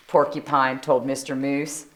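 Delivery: plain reading voice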